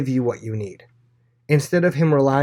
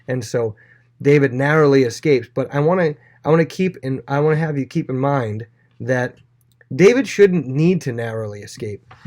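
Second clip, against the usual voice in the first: normal talking voice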